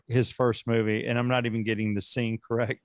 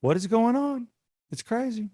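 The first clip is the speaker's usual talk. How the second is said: mock wife voice; 11 semitones higher than usual. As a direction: squeak it like an exasperated wife. Voicing wife in bit.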